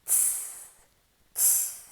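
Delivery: ts as a crisp unvoiced tap, like a snare; drum-like ts. Shown as a demonstration. Teacher shows the ending as a drum beat. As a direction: tap out ts like playing the drums. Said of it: "like a drum".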